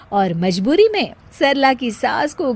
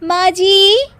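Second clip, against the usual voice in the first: much higher, louder, slow drawn-out call; 10 semitones higher, 5 dB louder, slower than usual. Acted. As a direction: call lazily from the other room. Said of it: "अपने कमरे से आवाज देती है". (कमरे से सास को पुकारते हुए, ऊँची खिंची आवाज़)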